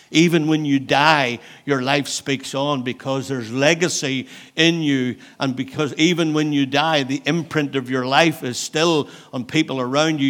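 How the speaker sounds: loud, rising preacher's exhortation; preaching. Real, not acted. Pastor urging the congregation back into the race, full-force preaching. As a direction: preach it out to the church.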